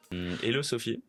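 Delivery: normal talking voice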